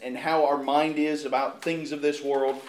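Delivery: usual speaking voice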